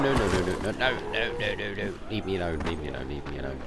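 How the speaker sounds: ordinary delivery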